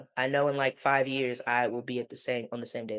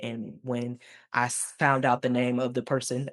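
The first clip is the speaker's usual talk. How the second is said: ordinary talk, nothing marked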